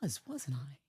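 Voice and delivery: very soft, tiny reply; small sheepish voice. Acted. Wife answering her inner voice, sheepishly soft. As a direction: say it barely audibly, as if privately conceding.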